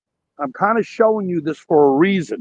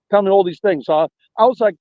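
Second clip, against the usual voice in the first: ordinary talk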